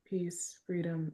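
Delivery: normal talking voice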